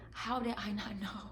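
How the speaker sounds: soft, choked, almost whispered question; teary hush. Retold or imitated. Recalling crying after the dream, she asks herself very softly. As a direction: let it barely come out through tears. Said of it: "I was just crying 'cause I'm like".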